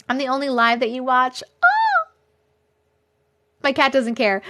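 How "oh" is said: very high little "oh"; surprised squeal. Real, not acted. Startled reaction during live chat.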